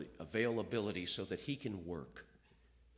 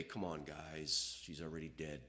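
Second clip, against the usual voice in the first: dismissive excuse; 8 semitones lower, 8 dB quieter than usual. Retold or imitated. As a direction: brush them off with a weary excuse. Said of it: "rationalize and say".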